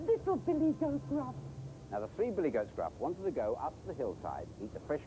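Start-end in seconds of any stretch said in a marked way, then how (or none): 0.1-1.3 much higher for the littlest goat; tiny goat voice. Acted. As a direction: pipe it up like the smallest kid goat.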